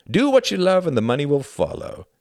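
high, loud, cheery saying; jovial pilot. A narrator's reading. Pilot encouraging Klaus about the hobby, jovial. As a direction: toss it out high and bright.

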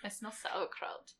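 hushed, slow whisper; mock whisper. Friends joking about German accents, mock hushed whisper. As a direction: breathe it like a spooky secret.

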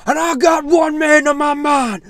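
high, full-force wrestling-style shout; imitated screamed callout. Fighter making an amazing WWE callout, screaming. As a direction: scream the challenge to the whole arena. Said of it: "he's, like, screaming".

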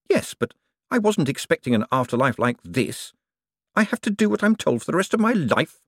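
a hint of a put-upon swindler; aggrieved conman. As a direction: object with mounting frustration. Moist protests his new life.

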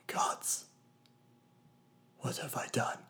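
halting, unvoiced whisper through tears; tearful whisper. A narrator's reading. Narrator to Nyx, whispering halting through tears. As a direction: choke the words out in a whisper. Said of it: "I whispered".

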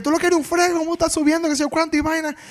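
high, mock-furious telling-off. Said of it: "Y ella fue, di que".

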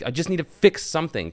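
high-pitched mock exasperation; imitated fed-up outburst. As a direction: act out someone at the end of their rope. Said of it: "he's so fed up".

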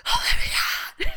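a high, slowed, breathy mock yell of the name; mock scream. Ex calling after her in the rain, a high breathy mock scream. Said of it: "Screaming my name".

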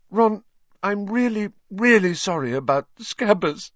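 high, tearful acted apology; sobbing apology. A narrator's reading. Hermione apologising to Ron about Scabbers, sobbing. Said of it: "she sobbed".

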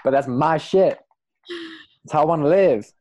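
high, loud Southern twang; Southern accent. Acted. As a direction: let the Southern accent out hard and fast. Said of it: "sometimes I do a Southern accent".

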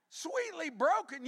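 high, slow, scornful quote of the title; mocking. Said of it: "wimpy song".

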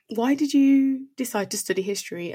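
usual speaking voice

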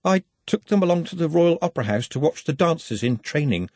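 mildly nervous, careful answer; nervously. A narrator's reading. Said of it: "said Nutt nervously".

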